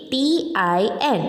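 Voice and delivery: slow letter-by-letter spelling. Shown as a demonstration. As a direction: draw out each letter for pupils. Spelling out a word for pupils.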